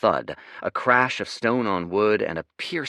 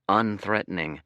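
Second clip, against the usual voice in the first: usual speaking voice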